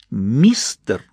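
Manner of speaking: a light contemptuous spit of the word; spat out. A narrator's reading. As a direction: mildly spit the word at him. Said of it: "почти выплёвывая в меня это слово".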